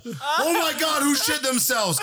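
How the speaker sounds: very high, hammed-up holler; appalled outcry. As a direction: wail it across the bar in mock outrage. Second bystander's retold outcry.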